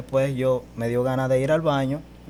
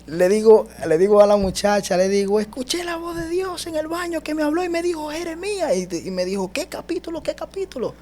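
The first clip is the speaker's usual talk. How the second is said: very high-pitched, thrilled retelling; excited breathless news; 4 dB louder than usual. Retold or imitated. Telling the woman about God's voice, excited and high. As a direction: blurt the amazing news in a high voice.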